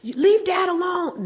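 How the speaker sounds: high, loud, slow protective yell; kids yelling. Retold or imitated. Children shouting at their mother to leave dad alone.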